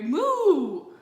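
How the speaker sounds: a loud, high cow moo bursting in. A narrator's reading. Olivia interrupting Francine's line with a loud moo. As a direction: cut her off with a big moo.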